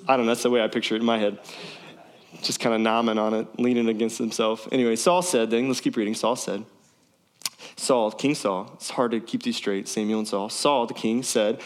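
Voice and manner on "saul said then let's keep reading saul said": normal talking voice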